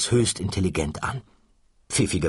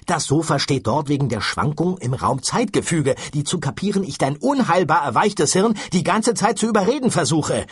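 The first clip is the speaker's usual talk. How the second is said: higher, louder, fast exasperated rant; ranting shout; 9 semitones higher, 4 dB louder than usual. Acted. Ford yelling at Arthur that they must catch the sofa, ranting. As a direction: berate him while racing on. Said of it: "brüllte Ford ihn an".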